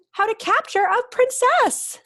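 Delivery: high loud gleeful announcement; excited reveal. Acted. Dragon teacher announcing the new lesson, high and gleeful. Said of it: "said Madam Dragon".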